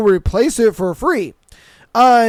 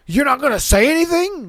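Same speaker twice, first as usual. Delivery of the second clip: mock-indignant customer, pitched up; irate customer; 5 semitones higher than usual. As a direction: imitate an annoyed customer, voice raised in pitch. Imitates a customer yelling at him.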